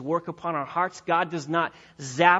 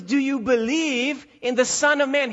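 higher, louder earnest question as Jesus; voicing Jesus; 7 semitones higher, 5 dB louder than usual. Jesus asking the healed man to believe, earnest higher question. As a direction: ask it as Jesus pressing the man.

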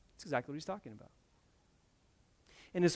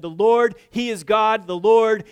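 loud, high triumphant shout; crowd proclaiming; 6 semitones higher, 15 dB louder, slower than usual. Acted. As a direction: cry it out as a worshipping crowd. People fall on faces, proclaim.

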